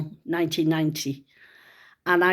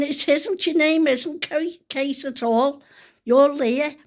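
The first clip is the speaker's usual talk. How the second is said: insistent correcting; 6 semitones higher, 3 dB louder than usual. Retold or imitated. Mum arguing with daughter.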